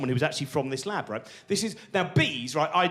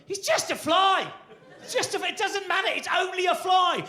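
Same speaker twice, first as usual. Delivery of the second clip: dismissive inner voice. Pretend. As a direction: brush it off breezily. Own mind dismissing the fly.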